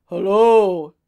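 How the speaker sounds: booming loud giant's hello; giant's huge voice. A narrator's reading. Giant hailing travellers on his road, in a huge voice. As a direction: bellow it out in a huge voice. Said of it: "in a huge voice".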